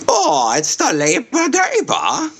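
high, slow, mocking "the Labor Day"; mock the phrase.

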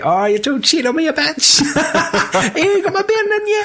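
squeaky high hammed-up rant; mock jealous husband. Friend mocks Anakin's jealous rant, high hammed-up voice. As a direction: camp it up high and whiny.

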